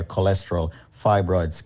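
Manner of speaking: usual speaking voice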